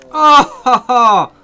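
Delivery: loud, high exultant laugh-shout; big gleeful "ha ha" of victory; triumphant crowing. Real, not acted. Triumphant cheer at own hunting kill.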